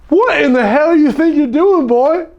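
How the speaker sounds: high, loud barking state trooper; mock-angry cop demanding answers; angry trooper shout. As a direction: yell it like a furious cop who pulled you over. Angry trooper voiced in story.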